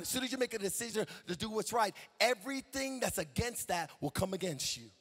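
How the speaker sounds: ordinary talk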